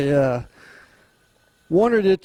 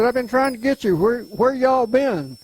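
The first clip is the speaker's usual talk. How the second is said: sister's voice, higher; imitating his sister; 8 semitones higher than usual. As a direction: lightly voice the sister asking where they've been. Sister calling, voiced by teller.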